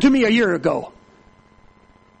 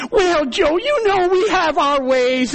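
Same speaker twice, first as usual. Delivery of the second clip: higher, slow pleading whine; whining church man; 7 semitones higher, slower than usual. Retold or imitated. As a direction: drag it out like a guilty excuse.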